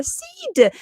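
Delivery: very high, drawn squeal of greeting; excited name call. Real, not acted. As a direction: sing out the name high in delight. Calling out a viewer's name.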